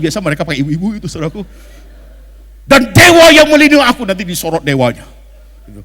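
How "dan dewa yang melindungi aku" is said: loud, high hammed-up vow of a film hero; Bollywood hero vow. Preacher mimicking Indian film hero vowing revenge. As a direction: declaim it like an Indian movie hero swearing revenge. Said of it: "lihat nggak mau ngomongnya".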